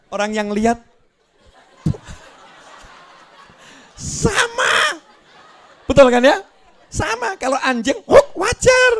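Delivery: high, loud laughing pity; laughing mockery. Onlookers mocking a man barking at a dog, laughing. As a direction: laugh at the fool barking back.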